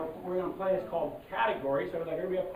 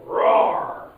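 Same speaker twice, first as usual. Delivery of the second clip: breathy roar like a tiger; mock tiger roar; loud tiger roar; 12 dB louder than usual. Shown as a demonstration. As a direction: roar it out like a big cat. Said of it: "you're gonna go".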